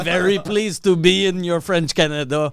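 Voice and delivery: English in a put-on thick Quebec accent; mocking bad TV casting in a Quebec accent. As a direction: play Minnesota Alan with a heavy Quebec accent. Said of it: "but with a thick Quebec accent".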